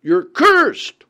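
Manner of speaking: loud, high yell, reenacting the man; a father yelling at his addicted friend, loud and high; retold shout. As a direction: shout it at him like he's hooked and won't quit. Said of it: "he just had to shout and yell at him".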